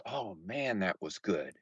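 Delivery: low, soft, contented murmur; satisfied sigh. Retold or imitated. Retelling how he'd sigh after overeating, quiet and low. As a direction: savour it like a finished feast. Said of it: "and then I'd go".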